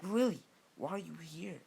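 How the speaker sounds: slightly angry tone. Acted. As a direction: confront the rival, lightly acted anger. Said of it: "like, an angry tone".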